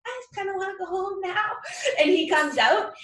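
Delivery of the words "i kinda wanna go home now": higher, softer, timid mock plea; like a frightened kid wanting to leave; scared. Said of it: "I'm scared".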